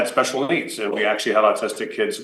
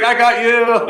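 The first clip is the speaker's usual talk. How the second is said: put-on high, loud kid crowing a prank; high loud triumphant kid; gleeful gotcha; 12 semitones higher, 6 dB louder, slower than usual. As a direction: crow it like a kid who just fooled you. Billy's prank reveal.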